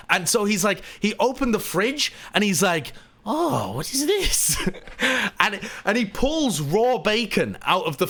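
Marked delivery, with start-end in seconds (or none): 3.2-4.6 put-on high, amazed Latvian-accented question; Miralyn's childlike wonder. Latvian guest opening the fridge, high accented wonder. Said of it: "insane curiosity and everything is amazing".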